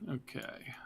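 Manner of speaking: whispered okay. Real, not acted. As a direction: breathe it without voice.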